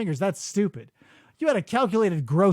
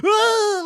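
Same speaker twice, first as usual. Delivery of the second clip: sudden high loud yelp; imitated jolt of a shocked yelp; electrocuted yelp; 14 semitones higher, 6 dB louder than usual. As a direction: yelp like you just got zapped. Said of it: "Like he gets hit with electricity".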